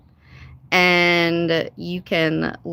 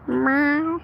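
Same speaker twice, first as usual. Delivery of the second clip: meow; 6 semitones higher than usual. Pretend. Plays cat.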